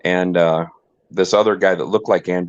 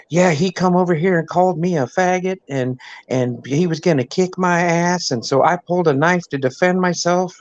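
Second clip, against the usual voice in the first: high, mock effeminate voice, pitched way up; mimicking the accused man's high voice; 9 semitones higher than usual. Mimicking the effeminate man to the cops, high voice.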